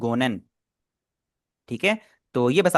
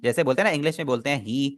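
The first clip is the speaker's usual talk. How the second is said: usual speaking voice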